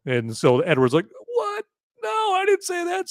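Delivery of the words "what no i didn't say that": very high mock whimper; imitating crying Edward. Condemned man denies refusing meal to guard, mock crying. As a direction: protest in a tiny, high, hammed-up tearful voice. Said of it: "he's crying 'cause he's obviously".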